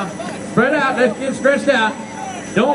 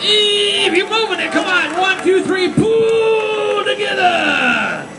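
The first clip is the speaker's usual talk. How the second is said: excited shouting; 9 semitones higher, much slower than usual. Real, not acted. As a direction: drive them on as the plane starts rolling. Cheering team as plane moves.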